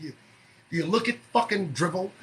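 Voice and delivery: ordinary talk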